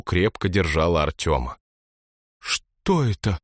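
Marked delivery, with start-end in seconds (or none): 2.4-3.4 put-on horrified girl. A narrator's reading. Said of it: "прозвучал такой ужас".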